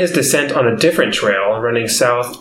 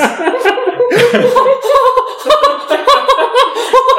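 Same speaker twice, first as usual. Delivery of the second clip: high wild squeal; 4 dB louder than usual. Pretend. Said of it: "He's gone way off the rails".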